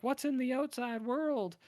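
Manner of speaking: put-on high, slow childlike wonder; wondering little girl. The sheltered girl asking her dad, high and slow. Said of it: "And then she's like".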